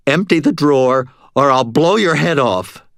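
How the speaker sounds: shouted threat. A narrator's reading. Nervous robber shouting at the stoned cashier. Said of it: "I shouted".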